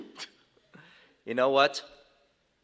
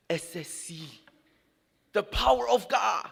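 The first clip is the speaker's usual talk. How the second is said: amazed joy; 5 semitones higher than usual. Retold or imitated. Mother quoted, amazed.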